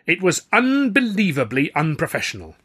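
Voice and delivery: loud, high condemnation; outraged rebuke. A narrator's reading. Star to the colleague who upstaged him, outraged. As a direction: condemn it loudly with high indignation.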